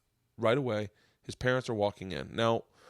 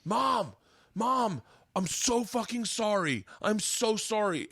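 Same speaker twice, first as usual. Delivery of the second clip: high, drawn-out apology to mom; emotional pleading; 9 semitones higher, slower than usual. Retold or imitated. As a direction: plead slowly, near tears, as the buddy. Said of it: "I'm getting emotional as he's doing it".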